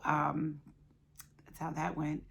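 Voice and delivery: ordinary talk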